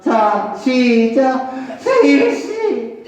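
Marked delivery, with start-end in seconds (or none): 1.8-3.0 high, melodramatic lover's call; implore, pitched up high; plaintive plea. Shown as a demonstration. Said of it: "애절하게 해야지". (높고 애절하게 부르듯)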